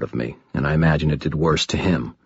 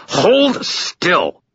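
high, strained snarl; snarled command; 12 semitones higher than usual. A narrator's reading. Wrenching a captive's arm, snarled higher. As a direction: force the order out in a raised snarl. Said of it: "I snarled".

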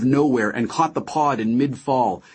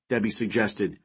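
ordinary delivery, nothing marked

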